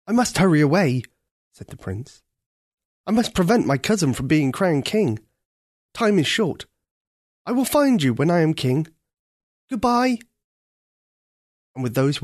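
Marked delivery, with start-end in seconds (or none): 0.1-1.0 louder, hurried prince; a prince with no time to lose; urgent prince. Acted. Prince rushing off at the trumpets. 3.1-10.2 louder, pressing prince; urgent prince. Acted. Prince vowing to stop the coronation.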